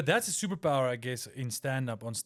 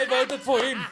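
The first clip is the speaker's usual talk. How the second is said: high, louder, laughing declaration; hammed-up pride through laughter; mock proud cheering; 9 semitones higher, 7 dB louder than usual. Host playing a fan of Mandela, laughing pride.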